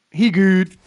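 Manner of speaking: loud, high-pitched slangy exclaim; loud hype. Acted. Gamer hyping his new player, loud and high. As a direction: shout it out like a hype man.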